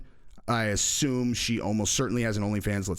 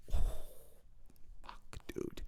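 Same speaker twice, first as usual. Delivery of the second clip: whispered swear under the breath; hushed, voiceless expletive; awed whisper; 9 dB quieter than usual. Real, not acted. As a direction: let it slip out as a stunned whisper. Awed reaction to a woman's picture.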